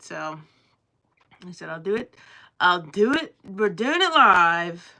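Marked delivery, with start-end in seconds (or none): 1.7-4.9 louder, building to a slow proud declaration; rally yourself, drawing out the last words; rallying cry. Retold or imitated. Retelling sudden decision, loud.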